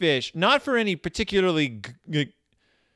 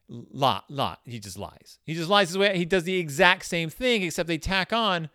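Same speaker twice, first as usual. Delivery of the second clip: normal talking voice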